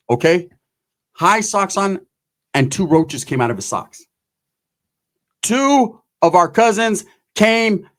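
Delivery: ordinary talk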